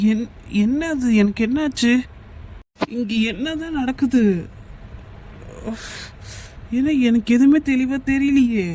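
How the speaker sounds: woozy, bewildered, pausing between phrases; drugged confusion. Acted. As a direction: struggle to make sense through a drugged haze. Drink spiked, man turning woozy.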